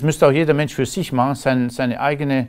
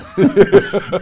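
high, loud burst of laughter; 7 semitones higher, 7 dB louder than usual. Real, not acted. Laughing at how he answered the locals, loud. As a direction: laugh at the memory. Laughs after his own retort.

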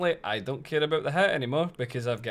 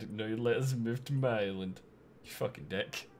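usual speaking voice

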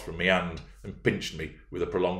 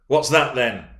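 raised, louder challenge; indignant retort, higher and louder; sharp retort; 5 semitones higher, 7 dB louder than usual. Acted. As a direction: throw it back at her. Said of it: "I retorted".

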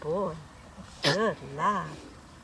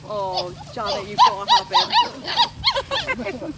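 gushing; 10 dB louder, slower than usual. Real, not acted. Gushing over the puppy.

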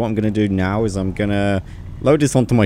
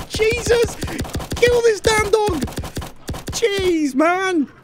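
high, frantic, panicked shouting; real terror while fighting back; panicked yelling; much slower than usual. Real, not acted. Fighting off attacking dog.

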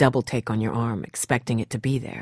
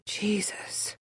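whispered; 6 semitones higher, 9 dB quieter than usual. Acted. Shocked under breath.

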